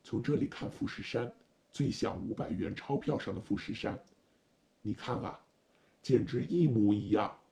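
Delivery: low-pitched driver showing a view; driver role. A narrator's reading. Driver pointing out Mount Fuji to his passenger, low-voiced. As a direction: speak low, building to an emphatic finish.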